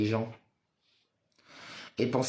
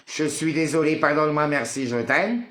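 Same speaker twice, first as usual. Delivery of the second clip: mantra thrown out louder and higher, with irritation; angry mantra; 5 semitones higher, 6 dB louder than usual. Acted. Streamer hurling the mantra at viewers, angry and loud. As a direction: spit the apology out like a jab. Mantra flung out after rant.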